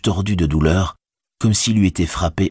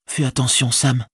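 higher-pitched, cautioning hobbit; anxious Frodo; 8 semitones higher than usual. A narrator's reading. Hobbit cautioning his departing servant, higher and concerned. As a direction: warn him in a raised pitch.

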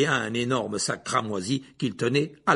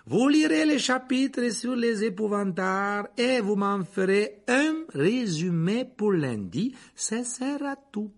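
high, slow announcement; teacher addressing class; 7 semitones higher, slower than usual. A narrator's reading. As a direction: spell out the homework slowly. Lupin assigns homework to the class. (consignes annoncées lentement, voix haute)

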